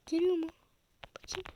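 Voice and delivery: normal talking voice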